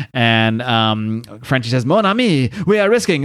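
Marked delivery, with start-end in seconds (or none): 1.8-3.2 put-on higher-pitched French accent. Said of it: "there, the French is back".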